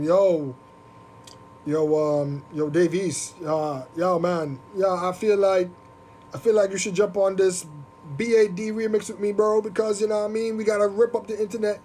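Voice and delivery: badly faked Jamaican rapper patter; horrible Jamaican accent. Safari pitching a remix to another rapper, bad Jamaican accent. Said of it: "Sorry, horrible Jamaican accent".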